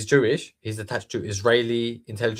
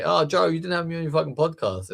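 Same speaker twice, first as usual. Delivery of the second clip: higher, mocking sob-story whine; mock crying whine; 7 semitones higher than usual. Mimicking Weinstein whining to Joe Rogan, mock tearful whine. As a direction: sneer at him like a grown man blubbering. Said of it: "he starts crying".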